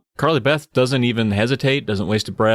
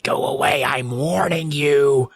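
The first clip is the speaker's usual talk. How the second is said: menacing warning; 3 semitones higher, slower than usual. Retold or imitated. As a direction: warn them slowly, doubling down. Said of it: "Just doubling down".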